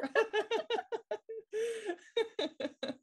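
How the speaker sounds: loud high giggling fit; high burst of laughter. Real, not acted. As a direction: break into a long high laugh. Laughs after a joke.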